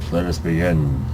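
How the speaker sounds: plain reading voice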